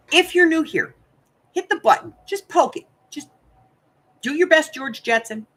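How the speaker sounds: playful, pleading subscribe call; playful high plea. Real, not acted. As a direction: cajole the viewer into subscribing.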